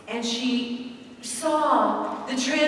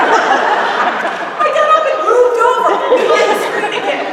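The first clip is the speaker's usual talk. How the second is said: laughing; 11 semitones higher, 11 dB louder than usual. Real, not acted. Laughing while telling it.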